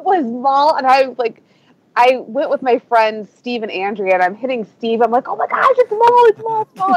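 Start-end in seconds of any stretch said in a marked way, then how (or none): 5.3-7.0 high-pitched, repeated fangirl exclamation; excited outburst. Retold or imitated. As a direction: relive frantically alerting a friend. Retelling excitedly hitting her friend.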